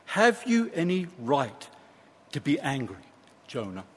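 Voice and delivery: softly questioning, sinking to a hush on the name; tender, low-key reproof; gentle correction. Acted. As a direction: correct him kindly, voice dropping away. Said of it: "with this gentle correction".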